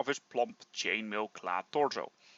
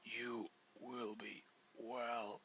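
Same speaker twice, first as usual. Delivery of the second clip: whispered, much softer, slowing to a last breath; fading dying whisper; 3 semitones lower, 12 dB quieter, slower than usual. Acted. Dying dwarf begging the hero, fading to nothing. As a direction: plead slowly, running out of breath. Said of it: "in a low whisper".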